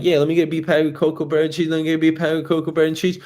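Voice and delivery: very fast, run-together ordering; rapid-fire order. Retold or imitated. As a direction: rattle off the deli order. Acted rapid deli order as teenager.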